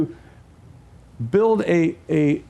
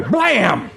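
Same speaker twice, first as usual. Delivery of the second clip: blam sound effect. Acted. Toddler hitting sound effect.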